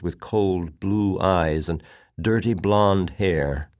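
usual speaking voice

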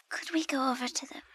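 fast, eager whisper; quick whisper. Acted. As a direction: hurry it out in a whisper.

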